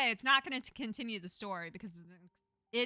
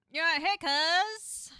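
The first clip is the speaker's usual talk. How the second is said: loud, drawn-out, high greeting; hammed-up cousinly hello; exaggerated greeting; 8 semitones higher, 9 dB louder, much slower than usual. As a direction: stretch the greeting out big and slow.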